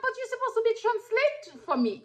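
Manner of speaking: high-pitched pleading objection; retold indignant protest. Mary expecting her friend to translate, high indignant protest.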